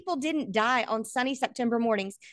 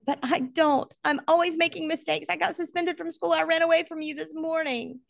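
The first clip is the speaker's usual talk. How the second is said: sobbing; 9 semitones higher than usual. A narrator's reading. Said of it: "Brandon sobbed".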